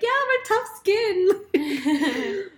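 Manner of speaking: put-on high, slow valley girl drawl through a laugh. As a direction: play up a bubbly sorority girl.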